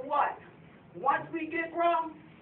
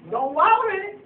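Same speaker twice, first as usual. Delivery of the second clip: raised, insistent repeat; emphatic quote; 7 semitones higher, 8 dB louder than usual. Retold or imitated. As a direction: drive the rule home loudly. Quoting again.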